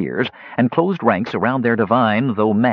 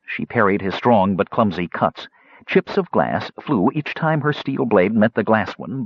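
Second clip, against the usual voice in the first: plain reading voice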